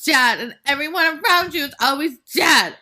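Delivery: hammed-up weepy wail; a dramatic teen girl crying over her dead friend; mock teen sobbing. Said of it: "'Cause you're like".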